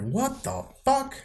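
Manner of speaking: high, drawn-out retold shock; imitating the dentists' stunned reaction. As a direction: stretch it out like you can't believe it. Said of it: "They're like".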